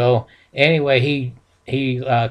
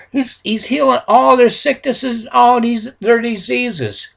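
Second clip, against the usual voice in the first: higher, eager voices telling of miracles; breathless with wonder at the healings; excited crowd report; 8 semitones higher than usual. Retold or imitated. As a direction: townsfolk telling Zadok excitedly about Jesus.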